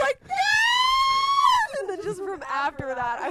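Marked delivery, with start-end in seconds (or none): 0.3-1.8 long, very high scream; loud drawn-out high shriek; panicked scream. Retold or imitated. Retold panicked cry in bed.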